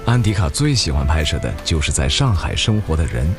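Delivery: usual speaking voice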